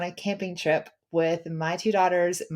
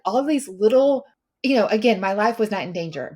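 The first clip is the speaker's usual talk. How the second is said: normal talking voice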